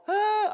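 high-pitched imitated "ah" scream; mock panicked scream. Preacher imitates the trapped Israelites, mock panicked scream. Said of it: "Y'all wanna scream like".

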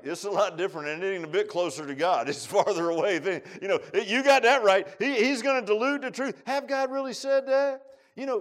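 loud, high shouted preaching; preaching at full force. Real, not acted. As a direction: rise to a roar.

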